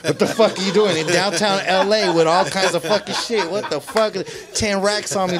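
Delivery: hammed-up incredulous rant; mock alarmed self-scolding. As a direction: berate yourself in comic disbelief. Scolds himself in retold realization.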